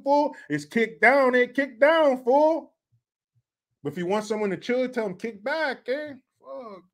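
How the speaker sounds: put-on Chicano homeboy accent. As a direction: do the Chicano schoolmates, lifting "fool". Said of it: "kids that sounded just like that".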